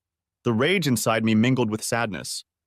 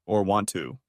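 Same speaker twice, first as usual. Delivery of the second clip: usual speaking voice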